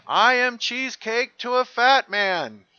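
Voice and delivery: high, loud, slow mock scream. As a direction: belt it out like an unhinged boast. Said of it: "And he screams".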